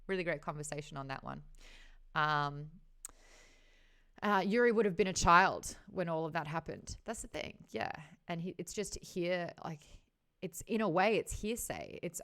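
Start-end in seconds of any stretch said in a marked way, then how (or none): none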